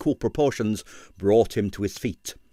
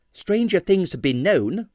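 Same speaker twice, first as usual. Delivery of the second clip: darkly; 8 semitones higher than usual. A narrator's reading. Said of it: "said Stanley darkly".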